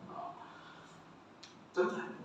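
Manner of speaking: ordinary talk, nothing marked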